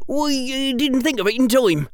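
high, fast, sheepish character voice; high-pitched hesitant excuse; apologetic boy character. Acted. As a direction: admit it sheepishly, pitched well up. Character dialogue in narration.

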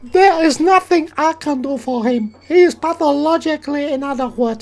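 high, loud mock Japanese woman; put-on falsetto with a Japanese accent; female Japanese psychiatrist. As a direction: pitch it up as a Japanese lady doctor. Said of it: "Female Japanese psychiatrist".

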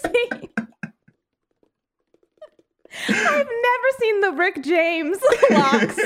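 high, laughing squeal; giggling while talking. Real, not acted. Laughing joke about Jesus hair.

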